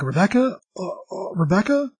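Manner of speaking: a high, disbelieving call of her name, twice; stunned calling out. Retold or imitated. Father finds his lost daughter in the river, calling her name in stunned disbelief. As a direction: call her name as if you can't believe it.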